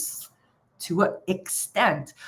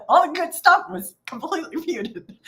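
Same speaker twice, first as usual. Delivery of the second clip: laughing; 8 semitones higher than usual. Real, not acted. Laughing at her own muted mic.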